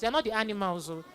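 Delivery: ordinary talk, nothing marked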